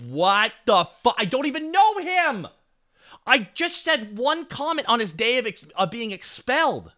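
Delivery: loud, high-pitched protest, acted out; outraged disbelief. Student learning he was on the list, incredulous.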